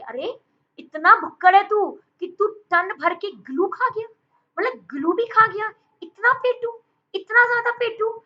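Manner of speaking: very high-pitched, loud, incredulous teasing; astonished mock scolding. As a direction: scold a glutton in shrieky disbelief, hammed up. Acted astonished scolding of a glutton. (हैरान होकर ऊँची आवाज़ में झूठी डाँट)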